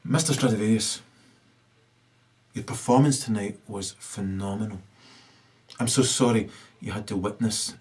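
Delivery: hushed, low, fawning usher voice; an usher whispering apologies to the violinist after the attack; low murmuring usher. Acted. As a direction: slip in softly like an apologetic usher. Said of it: "An usher walks up and says".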